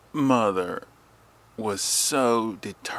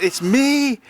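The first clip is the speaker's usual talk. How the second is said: calling out; 6 dB louder than usual. Retold or imitated. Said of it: "I said".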